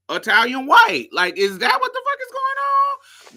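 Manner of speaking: very high, outraged disbelief; incredulous. Retold or imitated. Viewer questioning what the brothers are doing, incredulous and high. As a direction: climb high into a baffled demand. Acting out indignant disbelief while watching.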